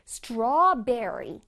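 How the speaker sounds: strawberry slowly, with the r in berry sounded; clear R. Shown as a demonstration. Teacher modelling the berry part, clear r in strawberry. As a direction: draw out straw, then a clear English r in strawberry. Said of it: "berry 부분에서는 R를 살려줘야 돼".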